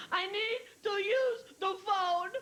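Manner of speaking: put-on high, slow, sobbing little girl; a tearful child begging her mom for the phone; crying child. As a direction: whimper it high and slow like a weepy six-year-old. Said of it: "downstairs twenty minutes later crying".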